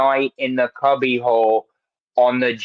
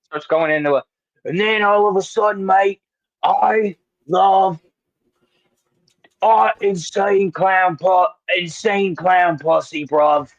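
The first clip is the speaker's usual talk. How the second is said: slipping into British; 6 semitones higher than usual. Shown as a demonstration. Host showing how his accent slides, in cockney. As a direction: let the accent drift into cockney, mate. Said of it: "See, it starts turning into that".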